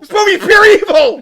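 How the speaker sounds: high, loud comic outrage; yelling. Real, not acted. Co-host condemning a movie he hates, yelling. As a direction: shout it like an accusation. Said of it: "I'm yelling".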